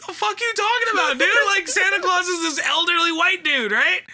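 put-on high, incredulous child's outburst; baffled retort. Third grader baffled by a classmate, high incredulous retort. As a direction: squeak out the disbelief as a confused kid. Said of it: "And I was like".

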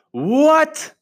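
high, loud furious roar. Acted. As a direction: roar it like an enraged queen. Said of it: "roared the witch".

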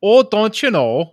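loud, drawn-out Minnesotan accent; singsong Scandinavian-English Minnesotan; Scandinavian-tinged Minnesotan. Shown as a demonstration. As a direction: stretch it into a high Minnesotan lilt. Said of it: "English speaking Scandinavian kind of thing".

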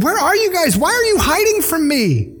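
voicing God searching for them; God calling out gently. God calling to hiding couple.

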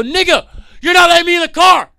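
high, loud furious shout; yelling at driver who won't let him in. Retold or imitated. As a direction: scream at the driver. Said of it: "I'm yell-, I'm mad at this guy".

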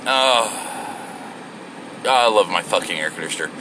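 ordinary talk, nothing marked

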